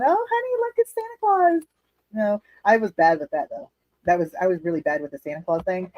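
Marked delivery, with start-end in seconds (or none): 0.0-1.6 high, sing-song fake cheer for the kids; fake cheerful parent. Parent faking Christmas cheer for the kids, high and sing-song. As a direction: put on a bright fake smile voice for your child. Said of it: "But you gotta put a smile on".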